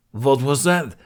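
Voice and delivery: loud gruff challenge; menacing growl. Acted. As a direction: demand it with a threat. Demyan menacing question.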